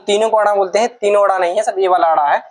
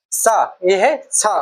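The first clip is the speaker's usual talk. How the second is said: slow demo; much slower than usual. Shown as a demonstration. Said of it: "हम इसको बोलते हैं".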